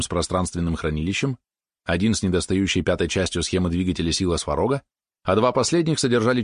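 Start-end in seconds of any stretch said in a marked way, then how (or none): none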